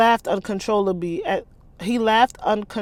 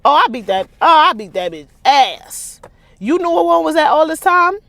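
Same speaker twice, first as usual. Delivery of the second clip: excited outrage; 8 semitones higher, 10 dB louder than usual. Real, not acted. Excited reaction to story.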